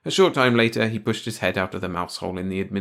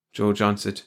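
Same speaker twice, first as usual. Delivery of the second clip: usual speaking voice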